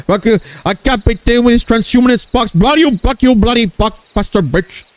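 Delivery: high-pitched ranting Indian caricature; comic cursing in Indian accent; mock Indian accent. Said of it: "this is my Indian impression of Lois".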